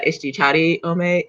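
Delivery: usual speaking voice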